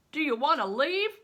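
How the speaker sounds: loud call to a stranger. Acted. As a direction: call it across to him. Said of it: "one of them called".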